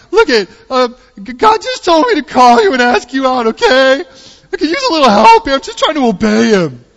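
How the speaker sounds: very high, loud panicked adolescent squawk; pubescent cracking voice. Retold or imitated. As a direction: play a flustered boy pleading with a breaking voice. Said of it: "Now I'm like a pubescent boy".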